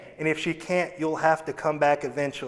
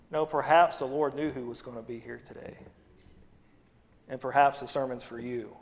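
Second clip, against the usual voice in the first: normal talking voice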